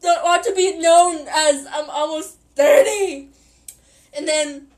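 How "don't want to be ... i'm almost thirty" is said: whiny, hurt little plea; mock-sad whimper, a bit louder; sad puppy voice. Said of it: "Like a sad puppy being like".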